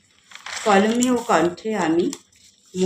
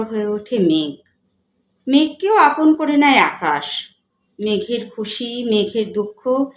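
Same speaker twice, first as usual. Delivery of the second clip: measured recital with lifted line openings and falling ends; poetry recitation; 2 semitones higher, 6 dB louder than usual. Acted. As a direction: recite the verse with feeling, pausing between phrases. Recites own poem on companionship. (কবিতা আবৃত্তি)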